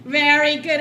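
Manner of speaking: plain reading voice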